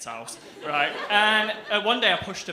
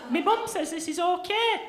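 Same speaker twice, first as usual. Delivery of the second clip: put-on smug kid; 13 semitones higher than usual. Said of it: "And I'm there".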